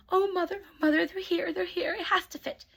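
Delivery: high, loud and eager; excited stepsisters. A narrator's reading. Stepsisters calling their mother when the guards arrive, excited and high. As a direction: squeal it out like the shoe is theirs. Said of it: "stepsisters are so excited".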